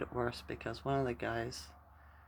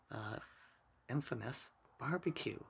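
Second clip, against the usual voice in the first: normal talking voice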